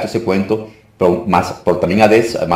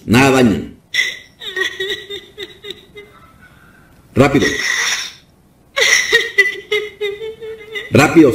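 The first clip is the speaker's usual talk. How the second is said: higher, forceful declarations; deliverance preaching; 6 semitones higher than usual. Real, not acted. Pastor freeing a woman from demons, commanding preaching. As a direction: issue firm commands to cast out evil.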